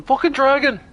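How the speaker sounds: high-pitched, loud pretend shriek; mock scream. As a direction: play at fleeing in panic. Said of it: "having people run away screaming".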